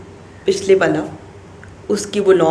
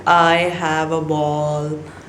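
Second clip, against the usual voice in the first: I have a ball drawn out very slowly, have stretched; slow modeled sentence; 3 semitones lower, much slower than usual. Shown as a demonstration. Teacher models the sentence for parents, very slowly. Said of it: "that word have".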